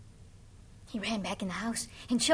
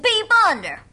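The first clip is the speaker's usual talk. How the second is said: high, loud order to peep; loud command; 10 semitones higher, 18 dB louder than usual. Acted. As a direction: call it out sharply to the boy. Witch orders boy under rock.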